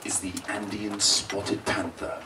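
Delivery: soft, slow nature-doc narration; hushed documentary narrator. Retold or imitated. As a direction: whisper it like a wildlife narrator hiding from the cat. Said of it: "the narrator would be like".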